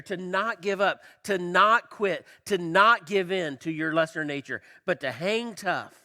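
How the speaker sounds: loud, punchy preaching; preaching emphasis. Acted. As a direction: drive each not home. Preaching perseverance, building intensity.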